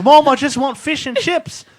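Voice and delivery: mock child plea; whiny kid quote. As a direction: beg like a small boy. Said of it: "And I'll be like".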